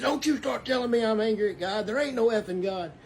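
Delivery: mock-heated denial from an angry stranger; imitating angry man. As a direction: throw back his angry retort. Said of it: "Because he said".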